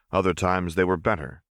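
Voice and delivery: ordinary delivery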